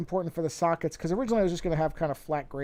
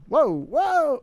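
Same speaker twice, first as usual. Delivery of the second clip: sudden alarmed whoa, much higher and louder; startled whoa; 12 semitones higher, 9 dB louder than usual. Real, not acted. Streamer startled by a popup, sudden loud whoa. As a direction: caught off guard by a popup.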